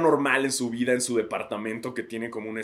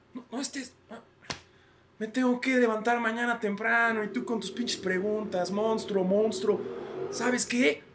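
high, whiny, fed-up father voice for the academy; the Spanish academy as an exhausted dad; tired RAE as dad; 10 semitones higher, slower than usual. Acted. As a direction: whimper it like a sleepy dad pestered by questions. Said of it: "es lo mismo".

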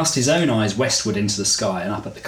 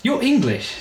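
higher, delighted woman's voice; surprised woman; 12 semitones higher than usual. Acted. Woman realising Byron is English, higher and surprised. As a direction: sound pleasantly surprised. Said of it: "said the woman".

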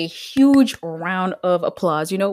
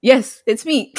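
ordinary talk

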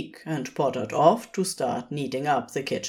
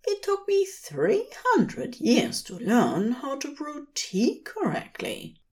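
higher, gentle fussy angel voice; fond angel; 9 semitones higher than usual. Acted. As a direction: coo it to your sleepy partner. Aziraphale voiced in story.

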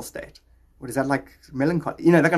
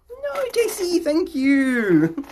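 excited thanks; 5 dB louder, much slower than usual. Real, not acted. Thanking someone off-mic.

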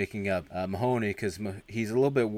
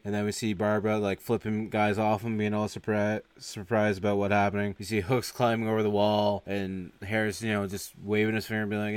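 ordinary talk

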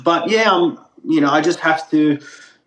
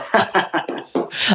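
breathy laugh; 6 semitones higher than usual. Real, not acted. Guest laughs about his broken accent, airy.

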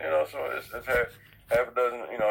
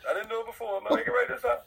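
lightly mimicked team owner, pitched high; slightly put-on high voice, imitating someone's resolve; Jerry Reinsdorf; 10 semitones higher than usual. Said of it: "so he's like".

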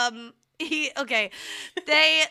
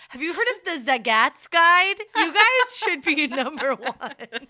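excited gushing; 6 semitones higher, 6 dB louder than usual. Retold or imitated. Voicing victim praising oysters.

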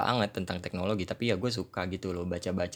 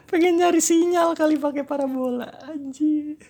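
high squeaky mock of herself; teasing herself in a high voice; 8 dB louder than usual. Mocking own blunder, high voice.

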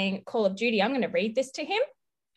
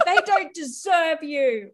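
normal talking voice

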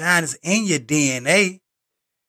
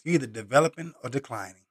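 softer, thoughtful rewording; quieter rephrase; 4 semitones lower, 7 dB quieter, faster than usual. Shown as a demonstration. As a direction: say it down low like a new idea. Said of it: "Let me say it like this".

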